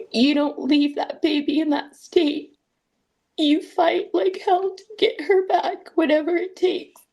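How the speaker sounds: quoting her brother's pep talk while crying; tearful. Real, not acted. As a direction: push the words out through tears.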